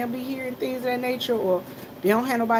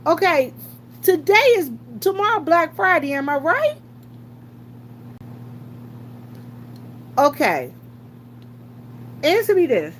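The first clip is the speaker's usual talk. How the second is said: louder, higher, fast exasperated rant; worked-up rant; 5 semitones higher, 3 dB louder than usual. Real, not acted. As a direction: vent about the store crowds.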